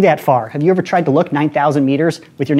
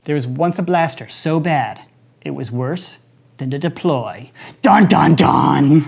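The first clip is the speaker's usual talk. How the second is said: ghost story voice; slower than usual. Pretend. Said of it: "about to tell ghost stories".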